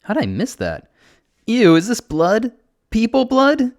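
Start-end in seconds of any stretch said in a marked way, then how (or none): 1.5-3.7 very high, loud, distressed squeal; about to cry. A narrator's reading. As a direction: recoil in disgust, nearly crying. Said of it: "She sounds like she's about to cry".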